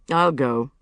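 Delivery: understated take-charge gallantry; manly responsibility. Acted. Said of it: "with a heavy air of manly responsibility".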